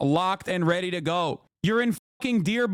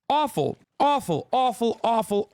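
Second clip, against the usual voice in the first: each awful punched higher; awful, awful, rising exasperation; emphatic repetition; 4 semitones higher than usual. Real, not acted. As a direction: hammer awful again and again. Repeated outraged emphasis.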